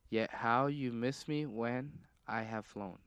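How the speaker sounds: slow, softened, deliberate recital; drawn-out reading of a riddle; weird riddle reading. Real, not acted. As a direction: read it out slowly, pausing before the last phrase. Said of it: "You're reading it so weird".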